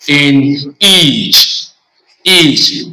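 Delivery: each said slowly as "eech", the vowel clearly drawn out; example word demo. Shown as a demonstration. Teacher sounding out each, a stretched "ee". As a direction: model the word each for students. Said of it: "as we have".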